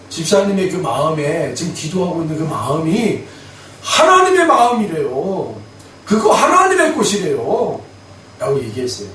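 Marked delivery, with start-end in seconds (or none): none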